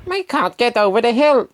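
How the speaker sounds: high, worried bus voice; Bertie the bus. Acted. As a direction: pitch up high for a fretful bus. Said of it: "Bertie said".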